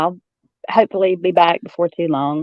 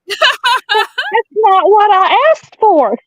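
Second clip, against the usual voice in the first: higher, slow imitation of a fussy mother; mom complaining; 3 dB louder, much slower than usual. Quoting mom's complaint about the wrong brand, higher and slow. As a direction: drag it out like a peeved mama. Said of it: "And she said".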